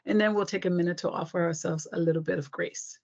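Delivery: usual speaking voice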